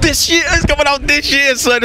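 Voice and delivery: excited surprise. Real, not acted. Interviewer learning from the developer the game's release date, excitedly surprised. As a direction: react to unexpectedly great news.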